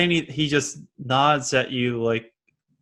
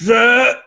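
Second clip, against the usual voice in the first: loud, high retching noise; strangled blurt turning into a retch; 11 semitones higher, 7 dB louder than usual. Acted. Game master acts out the man puking in front of Thelonious, loud high retch. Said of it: "and pukes".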